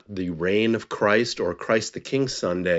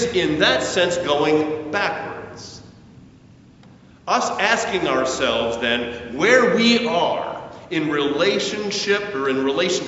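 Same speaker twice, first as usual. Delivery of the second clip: measured, rising and falling sermon delivery; sermon preaching; 6 semitones higher than usual. Acted. Pastor preaching to the congregation on using talents, earnest sermon voice. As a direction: preach it to the congregation, building on key lines.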